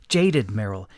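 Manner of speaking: ordinary delivery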